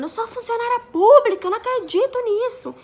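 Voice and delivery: high, loud indignant outcry; retold shouting. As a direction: protest at the top of your voice. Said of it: "ela tava gritando".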